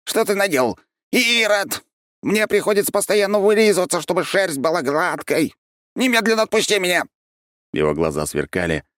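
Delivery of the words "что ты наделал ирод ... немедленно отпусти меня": high, shrill scolding; outraged creature. A narrator's reading. Curly-haired creature screams at the archmage, high and outraged. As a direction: squeal in wounded pride.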